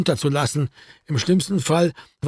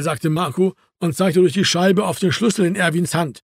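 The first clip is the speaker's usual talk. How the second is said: ordinary delivery, nothing marked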